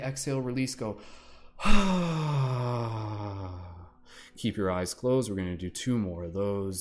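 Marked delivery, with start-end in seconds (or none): 1.0-3.8 long loud open-mouthed sigh; big sigh. Real, not acted. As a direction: let out a big audible exhale. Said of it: "nice big sigh".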